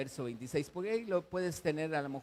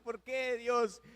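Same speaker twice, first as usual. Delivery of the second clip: anguished cry; 7 semitones higher, 3 dB louder, slower than usual. Retold or imitated. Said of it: "cualquiera estaría gritando, llorando".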